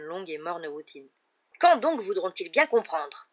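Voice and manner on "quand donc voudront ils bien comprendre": loud, slow indignant outburst; storming. A narrator's reading. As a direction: storm at them, hitting each word. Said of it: "tempêta l'oncle Vernon".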